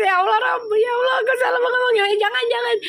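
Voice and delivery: giggly mock wail of embarrassment; laugh-crying. Real, not acted. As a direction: wail through helpless laughter. Laughing-crying at her mistake.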